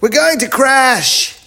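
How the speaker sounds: very high, drawn-out loud yell; frantic yell. A narrator's reading. Chip sees the crash coming, long high yell. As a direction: yell in panic, stretching the last word. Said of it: "yelled Chip".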